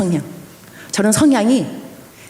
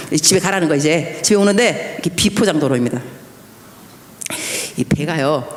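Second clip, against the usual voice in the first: ordinary talk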